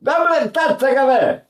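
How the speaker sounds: high lively Italian speech; Italian chatter. Retold or imitated. Teacher imitates how Italians talk, lively and high. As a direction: rattle it off like an Italian café order. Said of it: "How the Italians talk".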